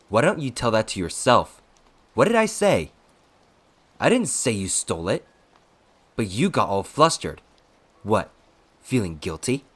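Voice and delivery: loud, heated comeback with pitch leaps; angry taunting. A narrator's reading. Attendant goading his flustered accuser, angry and taunting. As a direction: fling the accusation back, loud and high. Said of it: "He laughed from sheer anger".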